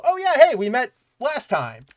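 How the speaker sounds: sudden high bright hello, then settling; cheery mock reunion hello; excited greeting. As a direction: pop up high and loud on oh yeah hey. Characters greeting Superman, acted quote.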